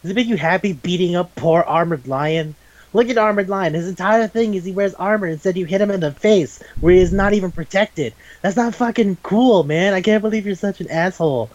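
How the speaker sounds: hammed-up reproach on a friend's behalf; Vamp. Vamp berates Sunred over Armored Lion, indignant imitation. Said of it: "Vamp, goes".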